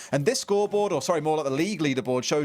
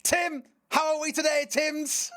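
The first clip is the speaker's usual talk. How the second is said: a put-on, much higher Mancunian accent, slower at first; 11 semitones higher, slower than usual. Caster greeting his co-caster in a mock Mancunian accent. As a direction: open the broadcast hamming up a Mancunian accent. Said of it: "I can't do the accent anymore".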